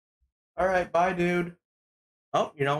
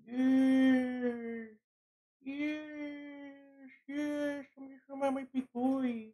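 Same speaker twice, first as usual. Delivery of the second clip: talking to a dog; 10 semitones higher, slower than usual. Real, not acted. Petting dog.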